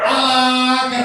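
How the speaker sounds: high, loud reveal shout; triumphant ta-da. Pretend. Teacher revealing the stick trick to children, triumphant. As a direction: announce the trick like a magician.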